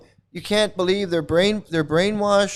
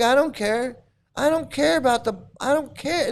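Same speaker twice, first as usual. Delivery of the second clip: high, dismissive sing-song; higher mocking "I don't care"; mock indifference; 8 semitones higher than usual. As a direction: mimic careless followers. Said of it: "They're like".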